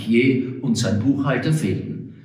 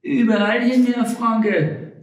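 much higher, sing-song woman's voice; Frau Rosalie's reply; 12 semitones higher than usual. Acted. Frau Rosalie answers Frank's complaint, high and breezy. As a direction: toss it off lightly in a high voice. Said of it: "antwortete Frau Rosalie".

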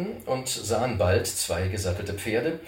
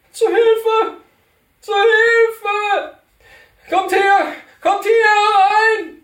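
shrill far-carrying shout for help, much higher; crying for help; 13 dB louder, much slower than usual. Acted. Tied-up cantor calling strangers for help, high shout. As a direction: scream for help from the bushes. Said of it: "eine Stimme ... rufen".

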